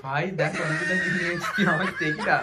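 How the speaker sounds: protesting through laughter; laughing. Real, not acted. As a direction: talk while giggling at the mess. (হাসতে হাসতে অজুহাত দেওয়া)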